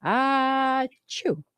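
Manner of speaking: single sharp sneeze sound; imitated sneeze. Shown as a demonstration. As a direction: fake one sneeze. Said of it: "one sneeze chick".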